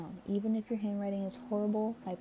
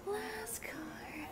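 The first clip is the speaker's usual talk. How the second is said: high, soft whispery echo; playful whisper; 13 semitones higher, 10 dB quieter than usual. Real, not acted.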